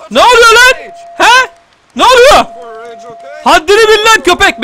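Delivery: high, loud challenge; aggressive game shouting. Pretend. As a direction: yell at enemies to know their place. Confronting enemies in gunfight. (hiddetle bağırarak meydan okuma)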